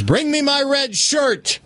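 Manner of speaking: high, slow commanding call; captain calling to the first mate; captain's order. Acted. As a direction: demand the shirt like a proud captain.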